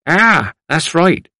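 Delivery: put-on slow, knowing agreement from an old man; old man agreeing. A narrator's reading.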